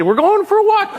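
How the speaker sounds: very high, gleeful dog; excited dog voice. Retold or imitated. Dog thinking it's going for a walk, very high glee. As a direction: chirp it like a thrilled pup.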